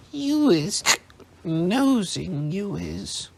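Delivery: slow, high slurred accusation; sulky tipsy elf; accusing drunk elf. A narrator's reading.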